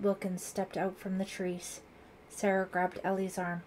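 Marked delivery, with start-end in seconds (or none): none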